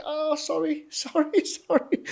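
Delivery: high, laughing sorry, sorry, sorry; sheepish apology. Retold or imitated. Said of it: "I was like".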